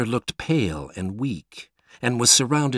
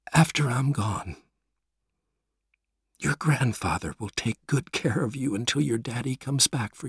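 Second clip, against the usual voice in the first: fading, softer voice of a dying mother; weak dying mother; 5 dB quieter than usual. Acted. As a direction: let each word cost her strength. Dying mother speaks weakly.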